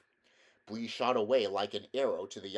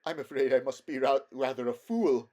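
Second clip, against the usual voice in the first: higher, louder, mildly shaken; 3 semitones higher, 7 dB louder than usual. A narrator's reading. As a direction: confess it as a humbled, rattled horse. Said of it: "in a shaken voice".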